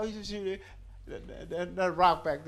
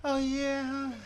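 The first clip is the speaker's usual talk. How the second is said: mock feminine voice; 4 semitones higher, 5 dB quieter, slower than usual. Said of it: "you wanna be all feminine".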